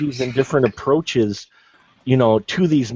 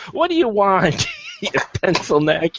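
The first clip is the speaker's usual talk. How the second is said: foul mouthed granny; 5 semitones higher than usual. Retold or imitated. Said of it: "the most foul-mouthed old lady ever".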